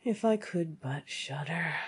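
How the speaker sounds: slow, soft, forlorn sigh; sad. Acted. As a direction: say it wistfully, softly and slowly. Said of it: "said quite sadly".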